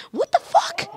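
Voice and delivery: high-pitched imitation of a shocked ghost; squeaky, play-acted disbelief; mock shock. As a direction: yelp it in pretend alarm. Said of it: "he's gonna be like".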